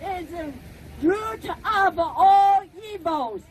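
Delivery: ordinary talk, nothing marked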